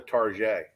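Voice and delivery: Tarjay said "tar-ZHAY", French style; mock-French Target, "Tarjay" ending in zhay; mock fancy pronunciation. Co-host likens it to what everybody says, mock-French "Tarjay". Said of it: "Everybody's saying".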